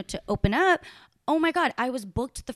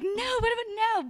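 mock refusal; 12 semitones higher than usual. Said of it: "Yeah, I'm like".

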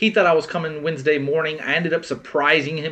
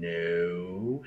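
low, hushed, hesitant reply; sheepish; 10 semitones lower, 12 dB quieter than usual. Retold or imitated. Caught friend answering about the weekend, sheepish. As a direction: shrink into a small, guilty mumble.

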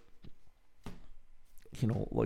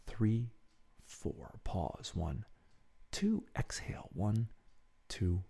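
soft, measured counting with even pauses; hushed meditation guide, spacing out each count; guided breathing meditation; 6 dB quieter, slower than usual. Acted. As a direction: pace the listener's breath, gently and steadily.

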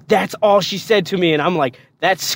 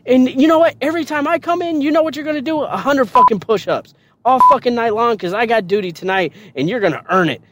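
angry drill sergeant threat; 7 semitones higher, faster than usual. Retold or imitated. Drill sergeant promising recruit hourly push-ups, angry threatening orders. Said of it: "she's angry, like, all the fucking time".

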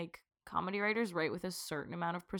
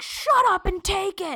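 higher, louder, slow scornful yell; 12 semitones higher, 9 dB louder, slower than usual. Retold or imitated. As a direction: sneer it out slowly. Showrunners mocked.

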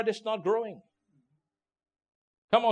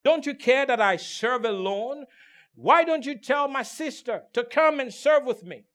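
raised, pleading protest to the Lord; Martha's complaint; 5 semitones higher, 5 dB louder than usual. Acted. Martha protesting to Jesus, a raised plea. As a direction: voice Martha's grievance, a touch higher and louder.

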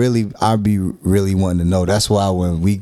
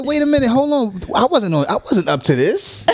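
much higher, hammed-up protest that slows at the end; mock outraged self; 11 semitones higher, slower than usual. Husband replaying his protest to his wife, hammed-up high pleading. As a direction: plead your innocence in a high squeaky voice. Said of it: "I said".

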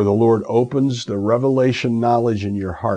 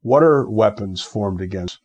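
ordinary talk, nothing marked

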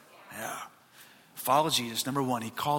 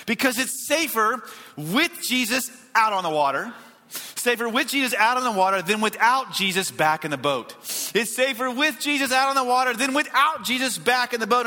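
emphatic, measured sermon refrain; fervent preaching; 8 semitones higher, 5 dB louder than usual. Real, not acted. Pastor giving the reason to step out, fervent preaching. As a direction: hammer home the contrast of with and without. Preaches key point at force.

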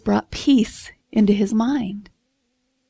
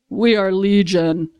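slow, eerie demon voice; lightly voiced creepy demons; demons' voice; 4 semitones lower, slower than usual. Demons answering Jesus, slow and eerie. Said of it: "And they say".

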